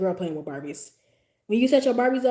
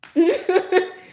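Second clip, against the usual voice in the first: high laugh; 10 semitones higher, 8 dB louder than usual. Real, not acted. Remembering the coworker's look, laughing loudly.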